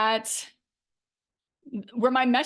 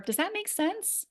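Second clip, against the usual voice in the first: much higher and softer, seeking approval; unsure question; 9 semitones higher, 5 dB quieter than usual. Shown as a demonstration. Coach imitating herself checking in with clients, higher and softer. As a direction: ask as if doubting yourself. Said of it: "this is actually one that I'm working on".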